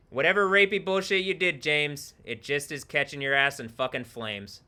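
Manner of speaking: loud mocking jeer at a player; loud taunt. Real, not acted. Streamer jeering loudly at a player named James. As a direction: call him out, loud and gleeful.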